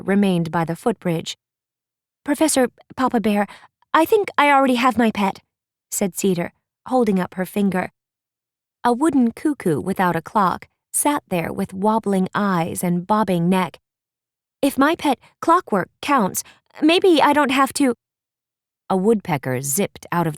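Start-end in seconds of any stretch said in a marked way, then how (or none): 2.2-5.4 put-on fast, higher, eager girl voice; a quick, chirpy wooden girl; Cedar. Cedar speaks up. 14.6-17.9 put-on much higher, hopeful girl; bright, hopeful plea, higher pitched; a hopeful wooden girl asking; Cedar.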